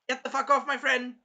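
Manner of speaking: high, loud acted command; mock angry order. Melty defending her friend from Moriyasu, mock angry order. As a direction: order them off, playing the protector.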